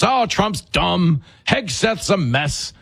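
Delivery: slow, drawn-out mimicry of critics; mocking parroting. Commentator imitating people who believe the media, slow mocking voice. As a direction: stretch it out to mock the believers.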